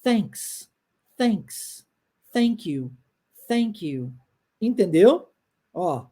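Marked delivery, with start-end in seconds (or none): 0.0-4.1 "thanks", "thank you" with the tongue between the teeth; unvoiced th drill. Shown as a demonstration. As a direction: model the th slowly. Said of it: "fique pronunciando".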